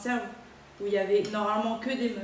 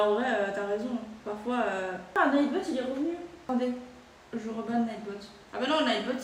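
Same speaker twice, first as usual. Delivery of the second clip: usual speaking voice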